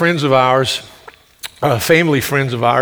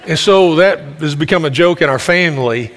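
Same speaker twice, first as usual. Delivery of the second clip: ordinary delivery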